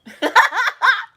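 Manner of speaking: a sudden, high-pitched burst of laughter. Real, not acted. Laughing at the movie Us.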